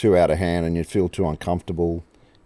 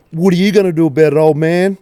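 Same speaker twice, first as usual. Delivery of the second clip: higher, louder, a hint of a cocky teenage boy; mocking cheeky teen; 9 semitones higher, 6 dB louder than usual. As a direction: jeer at the old man like a brat.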